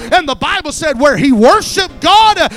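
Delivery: loud, high-pitched shouted sermon; impassioned pulpit shouting, punched phrase by phrase; full-force preaching. Acted. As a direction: preach at full cry, driving every phrase.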